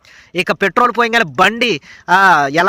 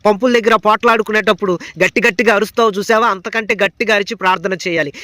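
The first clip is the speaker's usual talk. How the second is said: usual speaking voice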